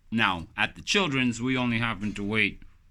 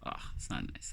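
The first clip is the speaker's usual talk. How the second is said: ordinary talk, nothing marked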